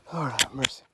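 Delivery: breathless whisper; whispered exhaustion. Pretend. Tired hiker to his friends, whispered relief. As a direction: sigh it out in a whisper.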